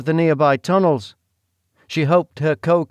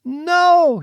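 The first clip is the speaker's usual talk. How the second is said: a high, loud defiant no; shout; 14 semitones higher, 7 dB louder than usual. Acted. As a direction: scream your refusal at the duke. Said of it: "she shouted again".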